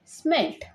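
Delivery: "Smelt" said as one word, high and emphatic; emphatic final word. Shown as a demonstration. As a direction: land the finished word high. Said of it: "SM means स्म".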